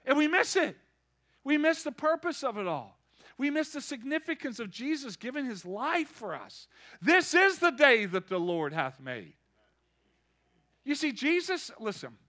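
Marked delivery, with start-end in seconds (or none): none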